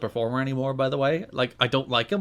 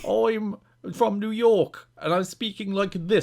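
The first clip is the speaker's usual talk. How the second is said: slow, higher mock New York accent; terrible New York accent; 6 semitones higher than usual. Host mocks the wrestler's accent with a terrible New York accent. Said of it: "This is my New York accent".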